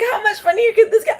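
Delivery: very high, laughing through the repeated thought. Shown as a demonstration. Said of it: "I think".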